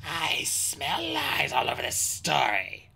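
slow snarl in a dragon's character voice. A narrator's reading. Skywing challenges the story before the queen, snarling. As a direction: draw out the accusation with a snarl. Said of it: "snarled a Skywing".

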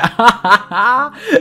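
high, loud gloating villain laugh. Acted. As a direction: cackle in triumph, up high and loud. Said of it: "ऐसा बोल के वो हंसता है". (ऊँची, ज़ोरदार खलनायकी हँसी)

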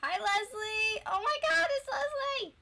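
high-pitched excited greeting; excited squeal. Real, not acted. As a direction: squeal with delight at seeing her.

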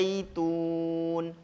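Wazaitun chanted with the long vowel held four beats; teacher shows the four-harokat length. Shown as a demonstration. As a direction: recite Wazaitun, holding the vowel for four counts. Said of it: "Empat harokat".

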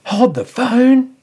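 high, drawn-out mock disbelief; mock astonishment. Host halting his fake news segment, in mock astonishment. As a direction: stretch it out, high and incredulous.

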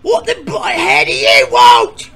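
loud, high rude yell; angry shout. Acted. Contestant yelling at the questioner, angry. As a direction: bark it impatiently.

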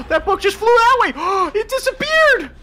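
high excited yelling. Real, not acted. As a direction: shout it out in amazement. Books vanishing, excited shouting.

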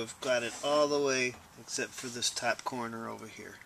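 ordinary talk, nothing marked

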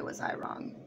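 hushed, ominous whisper; dramatic whisper. Real, not acted. Telling her viewers the night got worse, whispered. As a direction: drop to a whisper as if foreshadowing doom.

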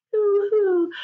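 high loud fake boo-hoo sob; mock crying. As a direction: wail a cartoon boo-hoo.

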